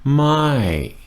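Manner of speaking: Mai said high, falling sharply. Shown as a demonstration. Teacher showing the Thai falling tone on Mai. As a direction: drop the pitch hard on Mai. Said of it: "falling tone".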